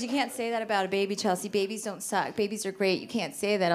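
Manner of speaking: low, soft scolding; disapproving sister. Retold or imitated. Sister chiding Chelsea about babies, disapproving. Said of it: "My sister goes".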